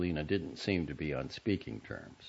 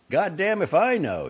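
louder, higher, hopelessly puzzled; as if the answer is beyond anyone; bewildered brother, louder and higher; baffled; 12 semitones higher, 9 dB louder than usual. A narrator's reading. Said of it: "his inflection implying that the answer ... hopelessly obscure".

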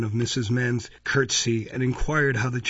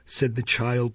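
ordinary delivery

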